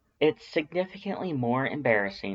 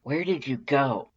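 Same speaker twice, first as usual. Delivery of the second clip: mildly threatening; 4 semitones higher than usual. A narrator's reading. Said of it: "not a little threateningly".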